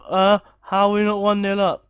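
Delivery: loud hammed-up manager rant in a changed voice; mock angry manager. Gamer acting as an irate manager at half time. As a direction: play a furious coach in the dressing room.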